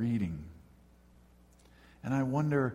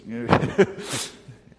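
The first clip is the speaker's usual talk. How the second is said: real laugh; 7 dB louder than usual. Real, not acted. Laughs at his own joke.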